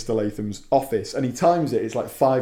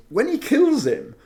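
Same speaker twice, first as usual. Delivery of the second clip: horrified; 6 semitones higher than usual. Real, not acted. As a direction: recoil as you tell it. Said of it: "It was horrible".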